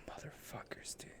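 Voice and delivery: fed-up whisper; whispered curse. Retold or imitated. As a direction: whisper it under your breath. Muttered curse about calls.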